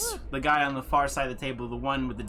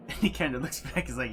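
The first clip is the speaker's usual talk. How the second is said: ordinary talk, nothing marked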